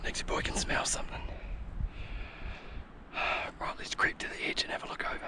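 soft whisper, creeping up; hunter's whisper. Real, not acted. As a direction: whisper so the game can't hear. Creeping up to hunt.

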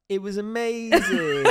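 high, slow, laughing gush; over-the-top enthusiasm. Shown as a demonstration. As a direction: gush it with fake excitement. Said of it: "I'm gonna do it again. Are you ready?".

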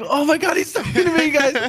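high, squealing excited fan voice. Retold or imitated. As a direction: squeal it like a starstruck viewer. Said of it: "the fifteen hundred people watching are like".

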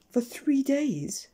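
high, slow, drawn-out disbelief; astonished exclamation. A narrator's reading. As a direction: stretch it out in amazement. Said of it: "exclaimed little Miss Neat".

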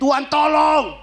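retold scream. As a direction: yell it as if the plane is falling. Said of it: "saya teriak". (teriakan panik yang ditirukan)

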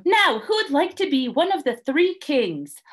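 high, organising voice; bossy Emu. A narrator's reading. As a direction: call for the next role. Emu runs the auditions.

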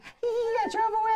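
squeaky high gloating mimicry; high giggly mock voice. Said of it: "and then she's like".

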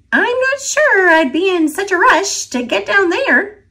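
high, knowing squirrel warning. A narrator's reading. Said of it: "said one of the squirrels".

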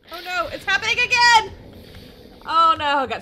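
loud, high cry of alarm; excited panic; alarmed shout. Real, not acted. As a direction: yell out as it happens. Lightning happens again.

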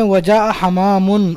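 ordinary talk, nothing marked

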